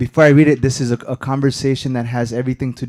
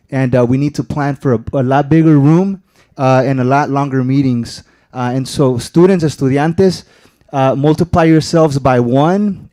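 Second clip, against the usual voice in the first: loud, driving rally to the room; rousing public speech; 8 dB louder than usual. Real, not acted. Student speaker rallying the crowd at the board meeting, loud and driving. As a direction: fire up the students to come back.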